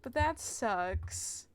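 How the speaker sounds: ordinary talk, nothing marked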